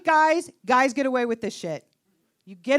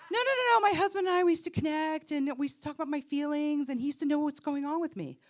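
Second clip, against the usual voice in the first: plaintive friend; 5 dB quieter than usual. Retold or imitated. Friend missing connection with her husband, mock plaintive voice.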